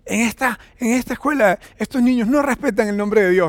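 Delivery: put-on a boy fuming that classmates disrespect God's name; a boy reporting his classmates to his father in outrage; retold angry son. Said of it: "Estaba todo bravo".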